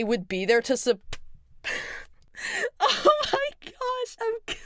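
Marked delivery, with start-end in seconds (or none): 2.8-4.6 high, slow, tearful break; crying. Real, not acted. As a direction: choke up for real. Overwhelmed by her own idea.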